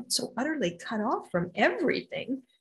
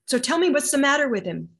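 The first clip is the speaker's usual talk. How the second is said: louder, higher retold demand; brisk demand; 4 semitones higher, 7 dB louder than usual. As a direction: demand an answer firmly. Healer's demand, retold, first time.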